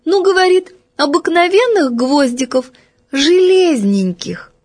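put-on little girl voice. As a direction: say it simply like a small girl. Голос Феньки. (высокий протяжный детский голосок)

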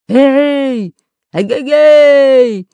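high, long-drawn loud holler; thunderous shout. Acted. Pirate hails the balloon, booming shout. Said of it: "закричал громовым голосом Буль-Буль".

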